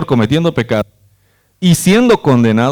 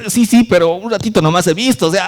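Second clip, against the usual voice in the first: sheepish excuse; 7 semitones higher, faster than usual. Retold or imitated. Benji excusing himself.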